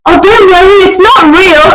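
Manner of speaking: high, soothing mock comfort; lightly voiced woman comforting a crier; kindly stranger. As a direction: reassure her like a stranger in the loo. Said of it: "this woman was like".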